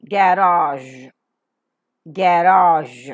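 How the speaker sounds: "garage" as ga-raazh, loud and drawn out; practice model pronunciation. Shown as a demonstration. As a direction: say "garage" firmly, holding the final zh. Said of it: "Let's practice".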